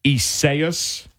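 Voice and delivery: Isaias said ee-SAY-us; another mispronunciation. Shown as a demonstration. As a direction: Isaias with the second syllable SAY stressed. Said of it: "It's not that either".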